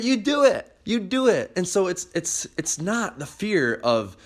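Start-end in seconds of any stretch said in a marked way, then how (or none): 0.0-1.5 emphatic urging. Real, not acted.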